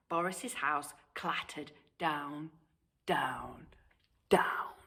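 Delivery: hushed storyteller whisper; suspense whisper. A narrator's reading. House collapsing.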